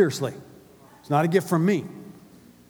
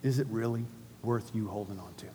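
repeated much softer and lower; hushed; 7 semitones lower, 9 dB quieter than usual. Shown as a demonstration. Pastor asking the congregation again, hushed. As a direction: let the question sink in quietly.

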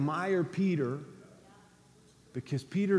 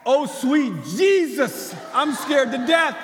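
slow, drawn-out mock terror, higher and louder; playfully hammed-up fright; 9 semitones higher, 8 dB louder, slower than usual. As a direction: ham up a disciple seeing a ghost. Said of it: "How about".

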